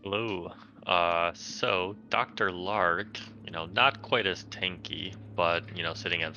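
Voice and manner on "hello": usual speaking voice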